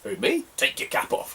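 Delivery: high "who me" then whispered disbelief; startled high reply dropping to a whisper; incredulous. Retold or imitated. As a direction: play it as shocked innocence. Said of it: "And I'm like".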